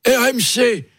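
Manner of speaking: put-on high, slow, quavering old man voice; Alain Duhamel impression. Comedian doing Alain Duhamel for the radio hosts, high and slow. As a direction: play an ancient reporter reminiscing, high and slow. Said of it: "il m'a dit, Oh".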